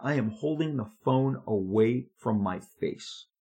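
ordinary talk, nothing marked

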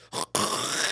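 noisy hawking spit; long rough hawk before spitting; American spit. Shown as a demonstration. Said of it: "Americans go".

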